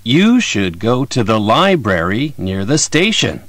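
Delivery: You should go to the library near the station with go, library, station strong; natural sentence stress. Shown as a demonstration. Model line for students to repeat. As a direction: stress the content words, reduce the small ones. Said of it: "アクセントを意識して言ってみましょう".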